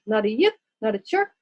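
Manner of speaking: plain reading voice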